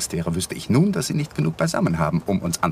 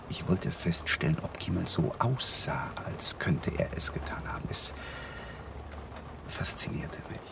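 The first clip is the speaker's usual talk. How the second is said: near-whisper, almost voiceless; barely audible admission; hushed confession; 5 semitones lower, 9 dB quieter than usual. Acted. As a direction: let it slip out under the breath. Confessing fascination with a murder.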